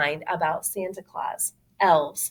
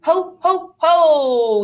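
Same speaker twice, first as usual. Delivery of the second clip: louder jolly ho-ho; Santa laugh; 9 semitones higher, 7 dB louder, much slower than usual. Acted. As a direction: boom it like Santa.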